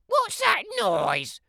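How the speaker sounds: a high, prim granny calling through the door; old granny voice. Acted. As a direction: call out like a frail grandma.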